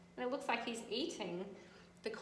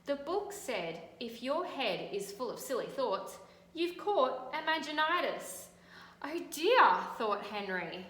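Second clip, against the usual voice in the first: louder, animated read-aloud; storybook reading; 3 semitones higher, 6 dB louder than usual. A narrator's reading. Teacher reading to children, animated storytelling. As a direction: make the tale vivid for kids.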